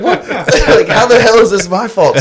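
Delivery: high, loud, laughing protest; laughing disbelief. Retold or imitated. Nephew retelling being blamed for the dent, laughing disbelief. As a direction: laugh through the outrage. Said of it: "And I'm like".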